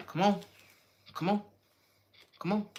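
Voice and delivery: pushy urging. Shown as a demonstration. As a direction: urge them on like an annoying coach. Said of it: "Somebody could say that like that".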